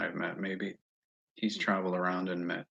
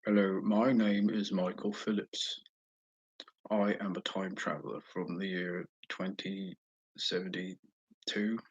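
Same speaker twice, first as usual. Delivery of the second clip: slow, badly faked English accent; Michael Phillips in bad English accent. Pretending to be a time traveler for viewers, bad English accent. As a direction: put on a poor English accent, drawn out. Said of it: "pretend to be Michael Phillips".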